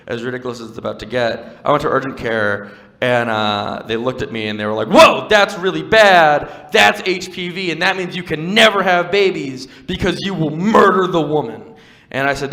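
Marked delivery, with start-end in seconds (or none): 4.9-11.7 loud, high, drawn-out mock alarm; hammed-up doctor delivering dire news. Urgent care doctor telling his patient bad news, hammed-up alarm.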